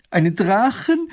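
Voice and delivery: high, loud and slow horrified gasp of a question; wife crying out in fear at the dragon news. A narrator's reading. Shrieks in terror at the word dragon. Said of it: "schreit Mathilde entsetzt auf".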